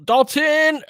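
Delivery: big high yell of the name; excited shout. Real, not acted. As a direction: burst out cheering the player's name. Excited call during live game.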